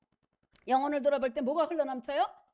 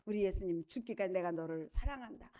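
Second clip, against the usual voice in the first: tender voice of Jesus; 5 semitones lower, 9 dB quieter than usual. Retold or imitated. Voicing Jesus' loving words.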